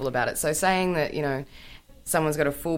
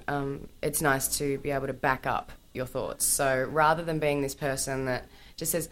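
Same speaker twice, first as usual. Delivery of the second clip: ordinary talk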